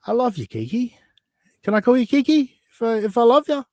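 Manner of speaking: high sweet-talking plea; mock affectionate. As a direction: coo it, pitch up.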